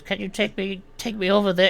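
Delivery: put-on high, pleading old man; old gnome voice. Old gnome begging the half orc for help, high and pleading. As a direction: ask like a feeble old gnome. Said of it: "He says".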